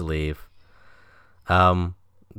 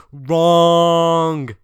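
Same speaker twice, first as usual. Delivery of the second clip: loud, high drawn-out shout; retold yell; 9 semitones higher, 9 dB louder than usual. Retelling the driver's refusal to stop the train, mock yell. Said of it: "he just yells".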